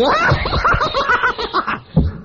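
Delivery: very high, loud, falling belly laugh; imitated long laugh. Preacher mimicking Hewlin laughing in the little car. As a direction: laugh like a man overcome with joy. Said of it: "then he laughed again".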